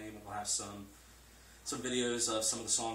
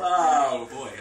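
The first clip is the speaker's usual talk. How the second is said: laughing; 4 semitones higher, 18 dB louder than usual. Real, not acted. Guest recovering from his slip, loud laughing sigh of words. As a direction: shake it off while still laughing. Still laughing after awkward phrase.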